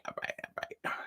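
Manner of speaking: unvoiced whispered all right. Real, not acted. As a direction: breathe it out as a whisper. Trails into whisper.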